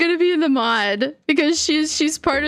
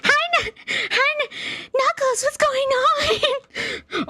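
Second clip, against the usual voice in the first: much higher, slow and giggly, as Amy; squeaky Amy voice; 13 semitones higher, slower than usual. Pretend. Voicing Amy greeting Knuckles, high and laughing.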